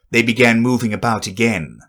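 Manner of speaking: ordinary delivery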